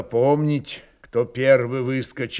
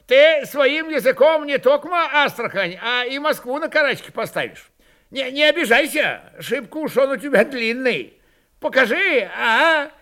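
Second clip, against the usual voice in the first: high, sing-song mockery; snide teasing; 11 semitones higher, 3 dB louder than usual. A narrator's reading. Kondrat mocks the old man's long tongue, snide. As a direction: bait the old man with feigned concern. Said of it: "ехидно заметил Кондрат".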